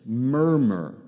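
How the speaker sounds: "Murmur" drawn out to sound like what it means; onomatopoeic murmur. Shown as a demonstration. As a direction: say "Murmur" as a long, low-pitched onomatopoeia. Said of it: "it sounds like how it actually is".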